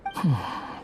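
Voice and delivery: a heavy breath out before speaking; sigh. Acted. Companion sighs at the idea of rewriting fate.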